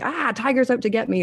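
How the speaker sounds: playful "tiger's after me" panic; mock panic. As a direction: act out a quick jolt of fright. Acting out adrenaline panic.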